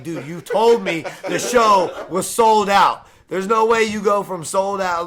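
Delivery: high, loud, slow retold shout at the promoter; outraged yell, words stretched out; indignant yell. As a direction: let him have it, disbelieving. Retold confronting the lying promoter.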